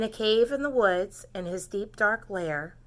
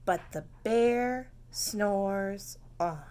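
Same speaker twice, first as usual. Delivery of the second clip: slow refrain; 4 dB quieter, slower than usual. Shown as a demonstration. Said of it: "You're gonna say".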